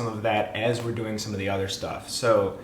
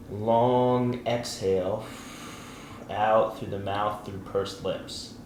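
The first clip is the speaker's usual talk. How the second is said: guided breathing. Acted. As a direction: talk them through each breath gently.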